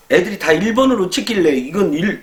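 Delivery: normal talking voice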